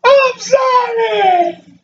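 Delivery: high, drawn-out mock wail; hammed-up tearful apology; mock crying. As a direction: wail it out slowly like a fake cry. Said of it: "you crying".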